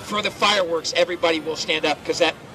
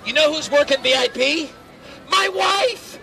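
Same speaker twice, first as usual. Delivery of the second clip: excited reveal; 8 semitones higher than usual. Real, not acted. Punchline reveal to crowd.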